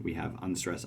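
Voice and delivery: ordinary delivery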